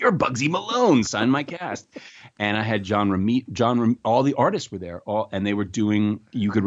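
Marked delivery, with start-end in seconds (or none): 0.0-1.9 high-pitched burst of fan excitement; excited young fan. Retold or imitated. Kid meeting a movie star at an event, excited. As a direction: blurt it out, starstruck and high. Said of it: "which was really exciting".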